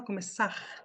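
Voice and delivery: "começar" ending in a breathy guttural R; regional final R. Shown as a demonstration. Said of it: "Tem gente que fala".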